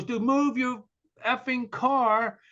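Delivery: high mock yell, drawn-out last words; imitated yelling. Tow truck driver ordering a motorist away, yelling. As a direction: imitate an irate driver's demand. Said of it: "he's yelling at me".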